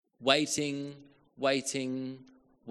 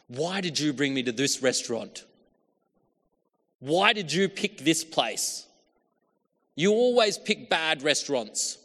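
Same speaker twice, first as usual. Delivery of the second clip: louder, higher mock grumbling; grumbling complaint; 4 semitones higher, 6 dB louder than usual. Diner grumbling at the partner who picked the restaurant, irritated. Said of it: "you start grumbling".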